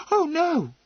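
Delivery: high, loud little outburst; involuntary dismay. Acted. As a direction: let it slip out in dismay. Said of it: "she said involuntarily".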